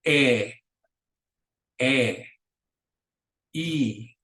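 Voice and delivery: each vowel said twice, short and pure, long pauses; "a, e, I, o, u" each held pure with no glide; Spanish vowels twice each. Shown as a demonstration. Teacher drilling the vowels for students to repeat, crisp and pure. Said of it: "let's go through the vowels specifically".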